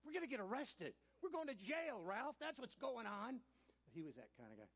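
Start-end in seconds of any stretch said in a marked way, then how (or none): 0.0-3.4 higher and louder, incredulous and urgent; exasperated retort. Retold or imitated. Teen in the police car snapping at clueless Ralph, loud and high. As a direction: tell him off in alarm. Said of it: "I said".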